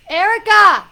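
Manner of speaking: very loud, high name call; delighted shout. Real, not acted. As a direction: shout out to a friend who appeared. Greets Erica as she appears.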